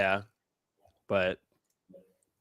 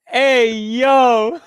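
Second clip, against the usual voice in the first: loud, high exclamation; scandalized call-out; 7 semitones higher, 8 dB louder than usual. Real, not acted. Reacting to an outrageous chat message, loud call-out.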